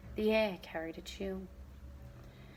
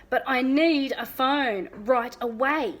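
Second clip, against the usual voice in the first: slow, louder, insistent demand; insisting angrily; 3 semitones higher, 7 dB louder, slower than usual. A narrator's reading. Father demanding a phone from the monster, angry and slow. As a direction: dig in and demand it. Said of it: "Dad insisted, glaring angrily".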